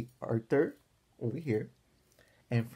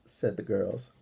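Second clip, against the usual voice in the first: ordinary delivery, nothing marked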